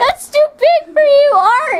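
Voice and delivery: high laughing shout. Real, not acted. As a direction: yell it out, laughing hard. Teasing sibling shouting again.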